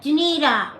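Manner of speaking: imitated husband cutting off the pleading; exasperated husband. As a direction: say the name as a mock reproach. Brad's weary reply to her pleading.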